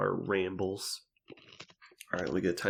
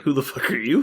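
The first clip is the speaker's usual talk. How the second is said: mock indignant challenge; 6 semitones higher, 6 dB louder than usual. Acted reply to doubtful greeting.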